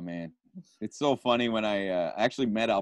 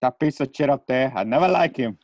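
louder, higher Tony Montana delivery; Scarface quote; 4 semitones higher, 6 dB louder than usual. Acted. Guest giving final thoughts as Scarface, a loud Tony Montana rant. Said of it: "That's my favorite line".

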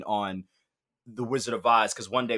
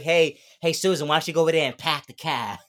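hammed-up Boston accent, a bit louder; mock Boston accent; 4 semitones higher, 5 dB louder than usual. Twin should have talked Boston to her sister, mock Boston accent. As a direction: play the twin as a loud Boston girl. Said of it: "she should have been like".

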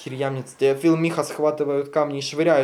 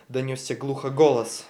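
ordinary talk, nothing marked

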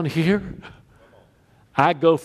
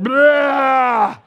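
loud, higher mock vomiting "blah"; retching noise; 7 semitones higher, 5 dB louder than usual. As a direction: imitate throwing up with a long retch. Imitates vomiting over a toilet.

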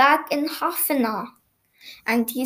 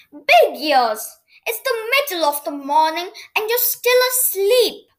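astonished reproach; 6 semitones higher than usual. A narrator's reading. Noddy finds his friend in bed.

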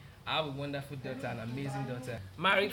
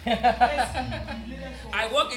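laughing; 7 semitones higher, 6 dB louder than usual. Real, not acted. Laughs while introducing himself.

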